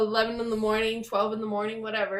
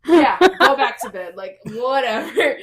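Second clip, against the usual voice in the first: dismissive; 3 semitones higher, 12 dB louder than usual. Retold or imitated. Mom dismisses husband.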